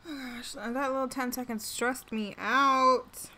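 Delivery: loud, drawn-out exclamation; stressed-out yelling. Real, not acted. Streamer yelling after ten stressful seconds of play, stressed out. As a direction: let the stress burst out slowly and loudly. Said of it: "Sorry if I'm yelling".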